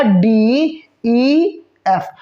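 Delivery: डीईएफ़ drawn out slowly, letter by letter; slowly spelled out. Shown as a demonstration. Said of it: "क्या?". (डीईएफ़ धीरे-धीरे एक-एक अक्षर)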